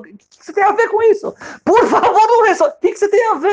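high, loud, laughing exchange; rapid pleading and retort; frantic back-and-forth. Retold or imitated. Husband and angry man arguing at the wedding.

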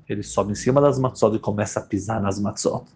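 ordinary talk, nothing marked

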